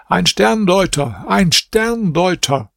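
hoch und langsam gedehnt ausgerufen; lauter Ausruf. A narrator's reading. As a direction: ruf es über den Palastplatz. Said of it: "rief hier mit lauter Stimme". (Prinz ruft vor dem Palast laut aus)

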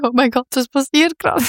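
high-pitched retold amazement; overwhelmed disbelief. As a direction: imitate being stunned on stage, voice lifted. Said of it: "mega überwältigend". (überwältigt, ungläubig nachgespielt)

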